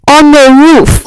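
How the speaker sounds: booming mockery that cracks upward; incredulous shout. Real, not acted. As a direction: yell it in disbelief at your own lie. Mocking his own absurd excuse.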